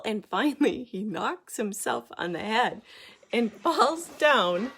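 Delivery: ordinary delivery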